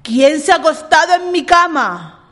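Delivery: papa bear, loud and big; angry papa bear exclaiming; big booming voice. A narrator's reading. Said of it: "un gran vozarrón".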